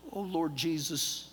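low, slow, soft; hushed thanks. Retold or imitated. Traveler given the seat, hushed thanks to Jesus. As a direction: breathe out thanks slowly and quietly. Relieved retold thanks to God.

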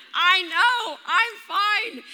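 hammed-up attempt to sound fine; flustered. Embarrassed runner answers two concerned old ladies, mock flustered.

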